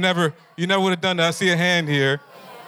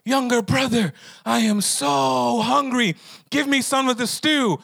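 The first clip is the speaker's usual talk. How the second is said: higher, slow, pleading big-brother voice; hungry Esau begging; 7 semitones higher than usual. Acted. Esau begging his younger brother for stew, higher pleading whine. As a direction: whine it like a starving older brother.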